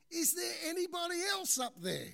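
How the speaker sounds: high, loud call for someone else; yelling out. Acted. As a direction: shout up the cliff in desperation. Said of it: "the man yelled out".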